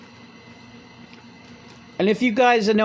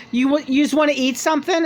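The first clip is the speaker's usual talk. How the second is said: [name] offering food; 8 semitones higher, faster than usual. Retold or imitated. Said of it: "she's like".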